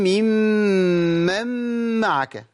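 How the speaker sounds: ممن معك with each م held and separated; correct triple mim. Shown as a demonstration. Teacher demonstrating the three doubled mims. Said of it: "كتير من الناس".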